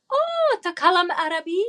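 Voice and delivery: high, bright attempt at Arabic phrase; learner's broken Arabic greeting. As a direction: try out clumsy Arabic. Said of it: "in my very broken Arabic".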